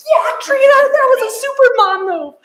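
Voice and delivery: very high, loud comic whoop; squealed, hammed-up celebration; triumphant self-cheer. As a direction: cheer yourself on in mock victory. Acts out cheering herself in triumph.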